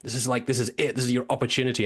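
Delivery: mirror pep talk, pitch up; psyching himself up in the mirror; urgent self pep talk before a take. Retold or imitated. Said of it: "he's just like".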